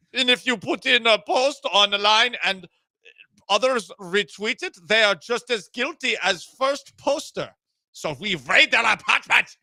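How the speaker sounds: high, loud cartoon German; German accent. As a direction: ham up a silly German accent. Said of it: "your German accent's".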